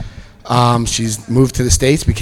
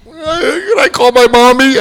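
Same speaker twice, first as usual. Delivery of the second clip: put-on high, slow, louder whimpering child; whiny little kid; 13 semitones higher, 5 dB louder, slower than usual. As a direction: beg like a scared little kid. Prisoner pleading for mom.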